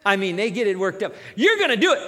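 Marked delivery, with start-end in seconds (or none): 1.3-2.0 higher, louder hype; excited cheer. Retold or imitated. Prophets hyping the king, a louder excited cheer. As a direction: pump up the king.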